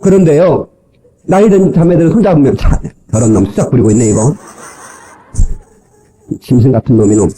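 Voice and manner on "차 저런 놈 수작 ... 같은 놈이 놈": low, muttered mock contempt; jaded scorn. Older woman whose hand is held, scornful and low. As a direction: imitate a woman unimpressed by advances. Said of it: "하나도 매력이 없는 거야".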